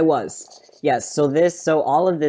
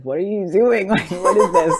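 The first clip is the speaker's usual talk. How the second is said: high, loud mock disbelief with a laugh; incredulous manager; 7 semitones higher, 6 dB louder than usual. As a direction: play the baffled manager, laughing. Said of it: "texted him and was like".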